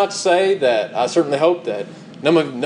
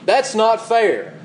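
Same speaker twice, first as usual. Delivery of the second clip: higher, drawn-out slow whine; whiny protest; 6 semitones higher, much slower than usual. Retold or imitated. Preacher mimicking a congregant's complaint, slow whine. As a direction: whine it like a sulking child. Voicing the whiny objection again.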